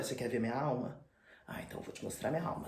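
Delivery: soft, threatening half-whisper; menacing hush. Retold or imitated. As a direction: lean in and breathe the threat. Dorian menacing Basil.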